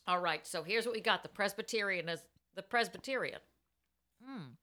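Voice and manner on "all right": ordinary talk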